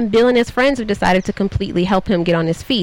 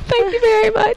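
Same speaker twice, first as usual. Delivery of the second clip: much higher, playful British accent. Shown as a demonstration. Host keeps her British accent going to accept praise. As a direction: thank them in a pitched-up British accent. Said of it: "That was very cute".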